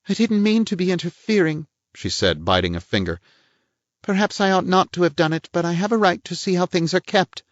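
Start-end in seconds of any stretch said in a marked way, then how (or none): 0.1-1.7 a higher-voiced prim society lady; lady's voice, pitched up and a little pert; voiced woman. Woman figure's lines. 4.0-7.4 a higher-voiced prim society lady; lady's voice, pitched up and a little pert; voiced woman. Woman figure's lines.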